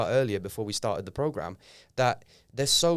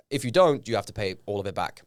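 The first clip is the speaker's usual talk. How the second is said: normal talking voice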